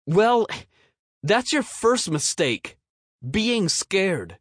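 put-on high kid voice aping a harsh father; stern dad words from a boy. A narrator's reading. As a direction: parrot a stern dad, loud start. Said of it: "let his daddy's words spill".

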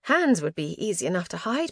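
plain reading voice

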